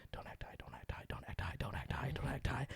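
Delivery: rapid whispered mantra, acted panic; panicked self-coaching. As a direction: whisper it over and over, very softly. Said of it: "I'd have a panic attack just going".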